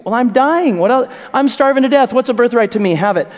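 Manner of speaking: high, loud desperate plea; hammed-up starving hunter; starving Esau. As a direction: beg like you're dying of hunger.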